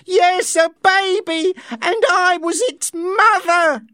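high, loud, slow desperate shout. A narrator's reading. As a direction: shout it at him for all to hear. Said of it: "Linda shouted".

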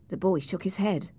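ordinary delivery, nothing marked

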